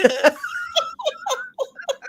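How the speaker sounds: high, squealing laughing fit; real breathless high giggling; helpless laughter. Real, not acted. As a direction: laugh uncontrollably at a silly joke. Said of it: "I can't stop".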